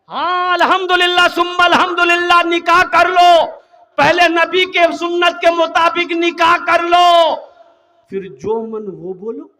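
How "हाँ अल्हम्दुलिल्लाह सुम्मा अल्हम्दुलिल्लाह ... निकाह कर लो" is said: fiery preaching. Acted. Preacher urging young men to marry, fiery and shouted. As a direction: thunder it at the crowd.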